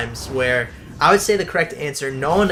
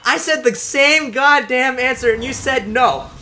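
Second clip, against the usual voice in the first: much higher and louder, slow and exasperated; indignant outburst; 10 semitones higher, 4 dB louder, slower than usual. Retold or imitated. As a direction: re-enact the outraged protest to the teacher. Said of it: "I'm like".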